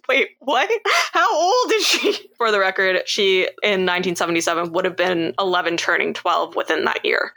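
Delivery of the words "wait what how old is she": high, incredulous laughing question; baffled disbelief. Retold or imitated. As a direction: laugh it out in shock. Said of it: "I'm like".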